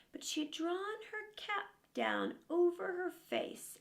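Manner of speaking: ordinary delivery, nothing marked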